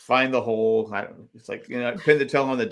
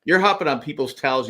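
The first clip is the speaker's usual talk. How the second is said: normal talking voice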